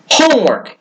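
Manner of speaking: high, sharp cry of homework; shouted. Acted. Boy protests to his mother, high shout. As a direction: fling the word out in protest. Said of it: "he shouted".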